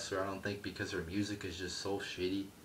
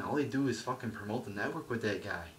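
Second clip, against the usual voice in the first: ordinary talk, nothing marked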